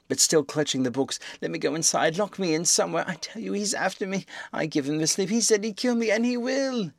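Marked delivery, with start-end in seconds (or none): 1.4-6.9 high pleading, rising to panic; weeping, frantic plea. A narrator's reading. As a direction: beg for shelter, terrified. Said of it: "staggering and weeping".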